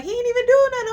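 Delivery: high indignant shout. Retold or imitated. Man defending his friend to the neighbor, shouting high. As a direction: snap back high and loud.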